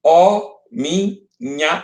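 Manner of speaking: "Ó minha" very slow, split ó... mi... nha; separação poética. Shown as a demonstration. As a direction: break "Ó minha" into long separate syllables. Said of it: "Vejam como é interessante a separação". ("Ó minha" separado em sílabas, bem devagar)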